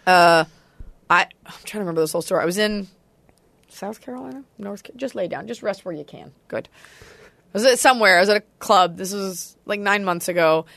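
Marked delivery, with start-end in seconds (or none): 3.7-6.7 very soft, half to oneself; quiet aside. Real, not acted. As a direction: drop far down to settle the dog. Trying to remember, settles the dog.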